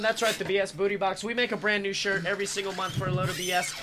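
hammed-up announcer plug; ad read. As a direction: sell it like a pitchman. Sponsor ad read.

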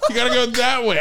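high, eager, over-helpful cop; apologetic Canadian cop. Retold or imitated. Canadian cop correcting his directions, high and over-helpful.

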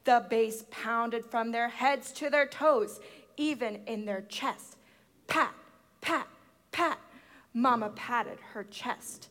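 ordinary delivery, nothing marked

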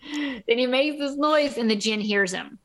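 normal talking voice

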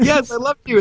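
high-pitched, giddy yes; excited acceptance. Retold or imitated. Retelling his yes to Tony's invitation, excited.

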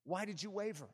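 soft, gentle-level asking; subdued question. Acted. Preacher voices Jesus questioning Peter, subdued and soft. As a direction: ask it quietly, held back.

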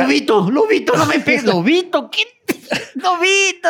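high whiny begging; mock pleading. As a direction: beg not to be hit. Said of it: "Y yo era".

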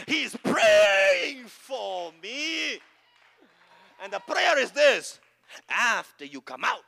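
high, rhythmic preaching cadence, building; fervent preaching. Acted. Preacher urging on the congregation, fervent and high-pitched. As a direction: pastor builds the crowd up, high and driving.

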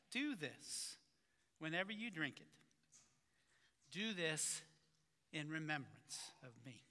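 measured, solemn reading of the passage; scripture reading. Acted. Pastor reading Scripture to the congregation, solemn and measured. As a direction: read the words of institution with weight.

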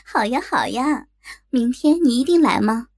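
ordinary delivery

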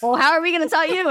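high, mock-exasperated retort; defensive retort. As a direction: imitate their defensive comeback, pitched high. Acted family argument, defensive comeback.

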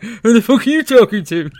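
loud, high mock challenge through laughter; mock indignant husband. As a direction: imitate an outraged husband while laughing. Husband's imagined reaction, laughing.

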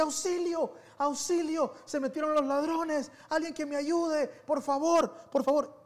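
high-pitched mock cries for help, retold; imitating a scared child calling for help; retold panicked yelling. Boy scared of burglars, retelling his cries for help. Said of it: "yo empiezo a gritar como loco".

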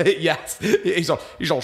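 ordinary talk, nothing marked

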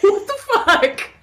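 high, laughing outburst of disbelief; laughing what the fuck. Real, not acted. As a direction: burst out laughing at the absurdity. Laughing at friend's revelation.

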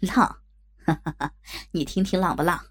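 chuckling, amused man; laughing boss. A narrator's reading. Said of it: "许老板笑着说".

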